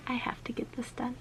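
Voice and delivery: a real soft whispered aside. Real, not acted. Speaker to herself, a soft whisper about getting it done. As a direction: whisper it to yourself. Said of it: "whispered aside".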